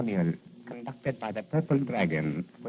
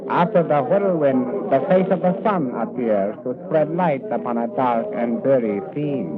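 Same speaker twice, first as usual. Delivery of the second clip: solemn loud proclamation; 4 semitones higher, 8 dB louder than usual. Acted. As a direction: declaim it grandly like a proverb. Solemn flowery greeting to Garrett.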